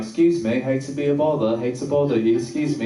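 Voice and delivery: badly faked British accent. Stopping a lady on campus, in a fake British accent. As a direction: put on a polite British voice. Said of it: "gonna use a British accent".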